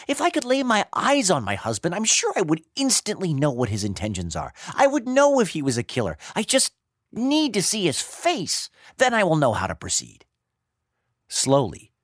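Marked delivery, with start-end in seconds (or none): 0.1-10.3 high, urgent heroine voice; determined young wife. A narrator's reading. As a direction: think aloud eagerly, convincing yourself.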